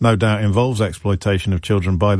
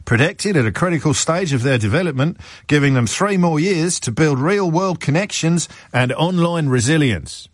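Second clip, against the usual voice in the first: higher-pitched put-on Australian accent; 6 semitones higher than usual. Radio host reading government statement on teen ban, put-on Australian accent. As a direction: read it out in a mock Australian accent. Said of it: "I'm gonna do the accent".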